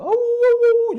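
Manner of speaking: high, long drawn-out dog whimper howl. Acted. Storyteller howling as the crying dog.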